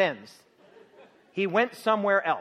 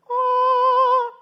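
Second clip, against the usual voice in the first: awed exclamation; 13 dB louder than usual. Real, not acted. Mocking Herod's divine display.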